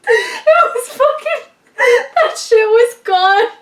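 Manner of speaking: high, loud, helpless laughter through the words; real cracking up, slow and squealing; laughing hard. Real, not acted. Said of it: "laughing so hard right now".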